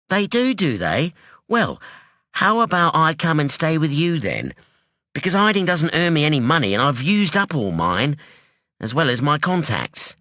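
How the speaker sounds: a sharp, wary daughter; hint of a guarded young woman; young woman Anyi. Anyi voiced in story.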